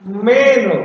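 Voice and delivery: "meno" with "me" high; "meno" said with a high first syllable; stress on first syllable. Shown as a demonstration. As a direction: lift the "me" in "meno". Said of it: "me-nya tinggi".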